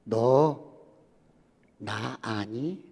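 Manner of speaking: low, slow divine voice; deep, slow voice of God questioning the professor; God's voice. Acted. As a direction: ask it slowly and low, as God to his servant. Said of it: "하나님의 음성이 들리는".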